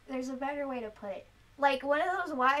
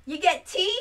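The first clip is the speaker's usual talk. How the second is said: put-on stern old lady ultimatum; 2 semitones higher, 8 dB louder than usual. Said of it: "she's like".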